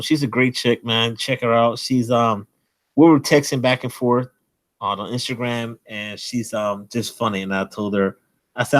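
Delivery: usual speaking voice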